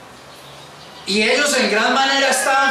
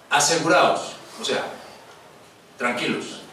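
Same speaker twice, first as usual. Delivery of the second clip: low, unhurried words with long pauses; acted calm, deep and spaced out; calm reassurance; 12 semitones lower, 6 dB quieter than usual. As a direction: soothe frightened men, quietly and simply. Voices Jesus calming the disciples.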